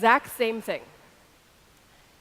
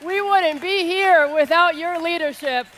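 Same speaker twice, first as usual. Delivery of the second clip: loud, raised voice to a big crowd; rousing shout-out; 6 semitones higher, 9 dB louder, slower than usual. Real, not acted. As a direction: belt out praise from the stage. Shouted shout-outs from stage.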